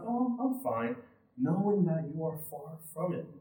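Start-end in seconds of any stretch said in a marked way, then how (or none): 0.0-1.0 default polite answer. Acted. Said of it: "your default answer is most of the time".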